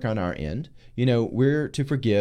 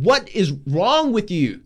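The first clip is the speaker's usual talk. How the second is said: slow, higher, louder scolding; exasperated Gabriel; 7 semitones higher, 3 dB louder, slower than usual. Acted. Gabriel rebuking stubborn Zechariah, slow exasperated scolding. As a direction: shake him awake with each slow word. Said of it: "you got louder and slower".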